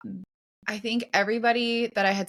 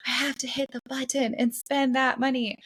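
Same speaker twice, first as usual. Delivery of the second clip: a touch higher, anxious self-quote; anxious younger self; 5 semitones higher than usual. Retold or imitated. Retelling her hesitation before buying the suit, lightly anxious. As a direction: voice her past self's nervous dread. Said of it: "I was like".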